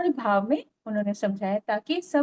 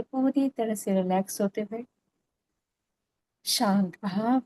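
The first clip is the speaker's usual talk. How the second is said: calm, even meditation voice with long pauses; guided meditation; 2 semitones lower, 3 dB quieter than usual. Real, not acted. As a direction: lead them gently into stillness, phrase by phrase.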